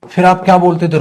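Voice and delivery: normal talking voice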